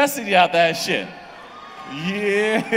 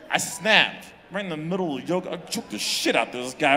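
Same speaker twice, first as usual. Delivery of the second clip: ordinary delivery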